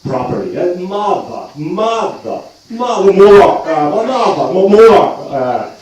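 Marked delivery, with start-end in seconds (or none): 0.8-5.4 mother said again and again in a pitched-up posh English try, vowel still Dublin; Dublin-sounding mother. Retold or imitated. Actor drilling the word mother for the stage, in vain. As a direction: keep trying mother posh but let the Dublin vowel win. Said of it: "I just couldn't say mother properly".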